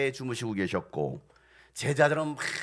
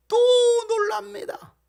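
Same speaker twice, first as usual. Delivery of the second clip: high-pitched cry of disbelief; exclaimed punchline; 6 dB louder than usual. Real, not acted. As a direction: exclaim it in exasperation. Exasperated punchline, disciples amazed again. (어이없다는 듯 높게 외치며)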